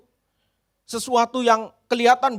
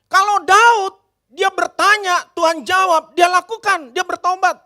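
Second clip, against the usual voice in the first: preaching; 5 semitones higher, 5 dB louder than usual. Real, not acted. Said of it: "preaching cadence".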